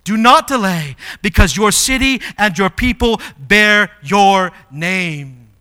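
loud, emphatic preaching plea to God; impassioned prayer. Acted. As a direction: cry out a bold scripture prayer.

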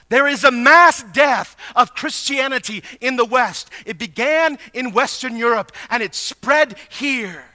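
high, loud, impassioned preacher; full-force preaching. Acted. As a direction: preach it hard, pressing each question on the listeners.

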